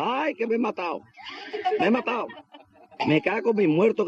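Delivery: high, wailing mock cries of pain. Fallen worker wailing to his mates, hammed-up pained cries. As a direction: ham up the fallen worker's wails. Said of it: "el gacho pegando gritos".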